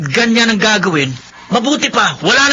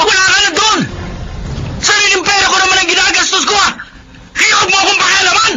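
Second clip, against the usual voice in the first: angry shouting; 11 semitones higher than usual. Acted. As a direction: yell back at your sister, voice cracking. Siblings' heated quarrel.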